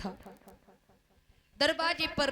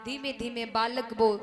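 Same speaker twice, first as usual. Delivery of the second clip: usual speaking voice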